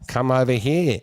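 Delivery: imitating an Australian girlfriend's accent; lightly mimicked Australian accent. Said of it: "that was what I battled with".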